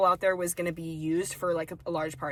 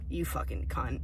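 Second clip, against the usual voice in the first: hissed under the breath, lower and softer; whispered insult; 6 semitones lower, 5 dB quieter than usual. Retold or imitated. Imagined aside about the hangry customer, whispered insult. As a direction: whisper the insult so he can't hear.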